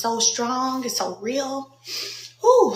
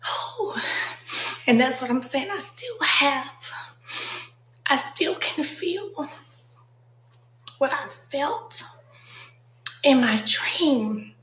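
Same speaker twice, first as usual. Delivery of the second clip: fighting back tears; 6 semitones higher than usual. Real, not acted. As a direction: voice pitched higher, near tears. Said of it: "I'm gonna try not to cry".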